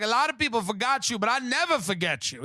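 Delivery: lightly put-on Spanish accent; a hint of a wannabe Spanish girl; gently mocking a devoted poster. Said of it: "She's like".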